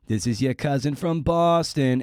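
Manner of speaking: hammed-up Boston accent, sneering at the commercial; mock Boston accent. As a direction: parody of a bad ad's Boston accent. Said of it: "as if that's a Boston accent".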